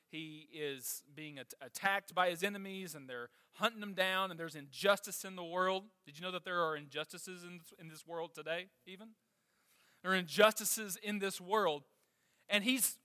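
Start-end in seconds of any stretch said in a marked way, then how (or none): none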